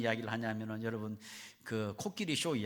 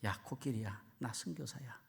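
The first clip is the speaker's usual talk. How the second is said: confiding in an ear; 7 dB quieter than usual. Retold or imitated. Missionary introduces himself into the elephant's ear, quiet confiding. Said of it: "코끼리의 기회대가 되고 이러는 거예요".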